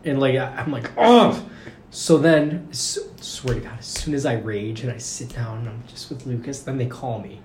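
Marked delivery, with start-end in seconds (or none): 1.0-1.4 loud high exasperated ugh; frustrated ugh. Retold or imitated. After mom says stay, groaning loudly in frustration. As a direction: let out a loud fed-up groan.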